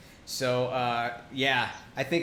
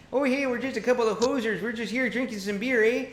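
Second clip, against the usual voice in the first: hammed-up Canadian hoser voice, higher; playful fake Canadian accent, pitched up; 9 semitones higher than usual. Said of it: "I know my Canadian accent".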